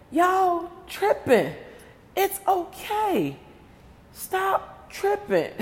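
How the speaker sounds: high, slow, mock-soothing coo. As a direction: talk them down like a fussy child, drawn out. Calming down power trippers.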